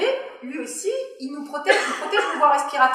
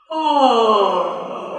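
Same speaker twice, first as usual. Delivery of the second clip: loud yawned oh; yawning; 8 dB louder than usual. Shown as a demonstration. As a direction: open the throat like a yawn. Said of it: "c'est bâiller".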